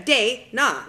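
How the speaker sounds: "Day, na" loud and split in two; emphatic syllables. Shown as a demonstration. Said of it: "change the spelling of my name to [name]".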